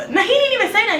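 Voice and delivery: ordinary delivery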